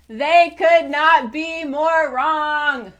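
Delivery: very loud, high and slow, stretched out; full shout. Shown as a demonstration. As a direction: shout every word, drawn out. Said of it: "I am now about to shout very loudly".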